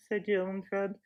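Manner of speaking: usual speaking voice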